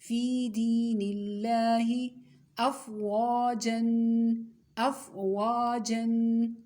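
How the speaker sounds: teacher reciting for pupils to copy, vowels drawn out; drawn-out recitation. Shown as a demonstration. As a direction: stretch each long vowel a full alif. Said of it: "इसमें आपने क्या देखना है".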